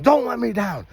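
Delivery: mild mock yell of the kids; imitated kids' scream. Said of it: "And then they scream".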